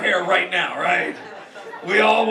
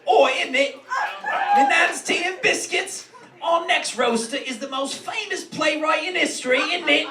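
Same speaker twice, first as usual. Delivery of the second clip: higher-pitched, hammed-up British accent; mock British accent; 5 semitones higher than usual. Roast host introducing the famous playwright, mock British accent. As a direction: put on a cheeky mock British voice.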